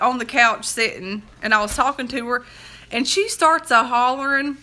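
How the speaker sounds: normal talking voice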